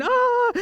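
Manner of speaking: a high hammed-up wail of crying; mock bawling wail. Two friends making up, high mock bawling wail.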